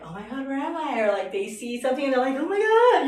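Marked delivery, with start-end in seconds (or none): 0.0-1.0 higher-pitched mild amazement; mock tripping out. People rolling at a rave, imitated mild wonder. 2.4-3.1 mock amazement. Ravers seeing something, loud mock amazement. Acting out people tripping out.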